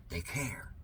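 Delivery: whispered mock sincerity; sarcastic whisper. Host mocks officials in a sarcastic whisper. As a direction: whisper it like a secret joke.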